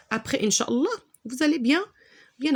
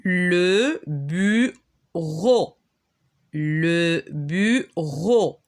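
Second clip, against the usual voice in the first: "Le bureau" drawn out very slowly, "bu-reau"; very slow reading; much slower than usual. Shown as a demonstration. Teacher reading a new word to pupils, very slowly. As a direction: stretch every syllable of "Le bureau". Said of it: "on va passer à l'autre page".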